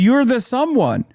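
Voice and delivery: outraged disbelief at the governor; incredulous rant. Real, not acted. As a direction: pitch jumps high in exasperation. Ranting at the governor's excuse.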